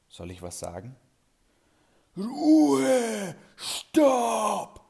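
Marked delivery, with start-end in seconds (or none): 2.2-4.8 huge roaring command, very high and loud; booming shout. Acted. Boss booms at the unruly class, roaring shout. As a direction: roar the class into silence. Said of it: "dröhnt es plötzlich durch den Raum".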